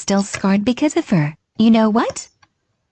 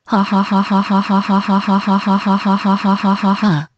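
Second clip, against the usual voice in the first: evil laugh. Acted. Prank victim about to drink.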